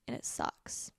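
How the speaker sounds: soft whispered aside; whispering. Real, not acted. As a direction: mutter it under your breath. Hushed bitter aside.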